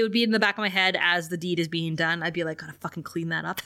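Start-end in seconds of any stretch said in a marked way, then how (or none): 2.6-3.6 quick, low grumbled aside; muttered inner thought. Acted. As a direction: mutter it quickly under your breath. Nagging thought during sex, acted.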